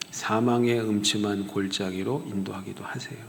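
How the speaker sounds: whisper. Acted. Whispered dark valley.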